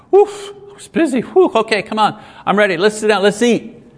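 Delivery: high, breezy late husband; a husband sweeping in late, acting casual; breezy husband arriving late. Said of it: "that little one-man play I just put on".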